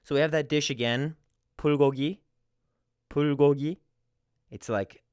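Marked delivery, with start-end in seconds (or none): 1.6-3.8 불고기 with a light P, barely any air. Shown as a demonstration. Teacher shows the initial letter in a dish name. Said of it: "a P and a B".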